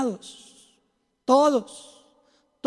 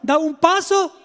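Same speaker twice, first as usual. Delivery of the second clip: normal talking voice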